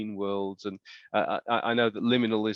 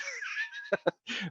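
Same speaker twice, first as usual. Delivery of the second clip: breathy laugh; 10 semitones higher, 6 dB quieter than usual. Real, not acted. Laughs at own remark.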